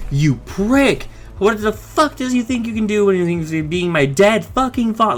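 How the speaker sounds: mock freak-out, high-pitched; Rusty. Rusty to his fake alien father, mock outraged rant. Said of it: "Rusty freaks out".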